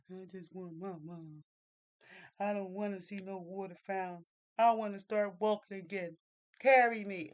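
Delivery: put-on whiny child. Lost boy whining to the narrator, building to a loud demand.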